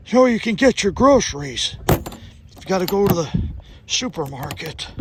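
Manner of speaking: a hint of a creaky grandpa; old man voice. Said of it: "ditch the old man voice".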